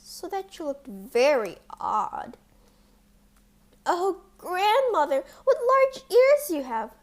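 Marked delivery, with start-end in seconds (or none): 3.9-6.9 put-on high, loud astonished child voice; amazed at grandmother's ears; wondering girl. A narrator's reading. Girl questions disguised wolf.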